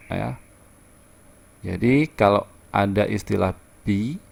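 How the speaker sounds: normal talking voice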